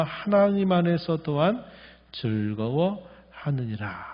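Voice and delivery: loud, measured Bible reading; scripture reading. Acted. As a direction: proclaim the verse to the congregation. Reads scripture aloud. (성경 낭독하듯 힘있게)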